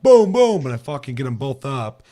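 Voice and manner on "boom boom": loud high boom boom gunfire noises; shouted pretend gun sounds; mock gunshots. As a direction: yell the shots like a kid playing. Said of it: "I'm like".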